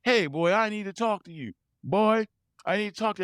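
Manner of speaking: high, hammed-up old dad hollering "boy"; mock old dad bellowing down the phone; loud obnoxious dad. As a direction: imitate a loud, obnoxious call from the old man. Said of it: "just loud call and obnoxious".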